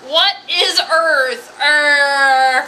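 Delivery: put-on loud, strained creature-child cries; hammed-up wild shouting with drawn-out words; excited fish-girl. Fish-girl discovering food on land, mock frenzy. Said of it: "she's like".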